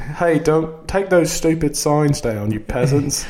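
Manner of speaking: put-on high British accent, slow; mock British scold; polite British scolding. Scolding peasants in a fake British voice, mock British. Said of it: "angry podcast and be British".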